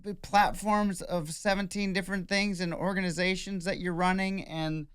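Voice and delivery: high, incredulous reeling-off of achievements; overwhelmed comic rant; self-doubting onlooker. Retold or imitated. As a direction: list it in high-pitched mock disbelief. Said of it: "you're like".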